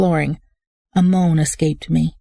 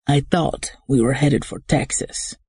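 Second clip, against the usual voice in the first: softer voice with a hint of Spanish accent; 2 semitones lower than usual. A narrator's reading. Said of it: "The voice was softer than Hyper Guy's, with a hint of a Spanish accent".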